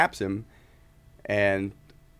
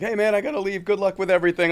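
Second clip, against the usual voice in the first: high, louder friendly farewell; friendly goodbye; 12 semitones higher, 6 dB louder than usual. Retold or imitated. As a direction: lightly voice the drummer. Larry's goodbye retold.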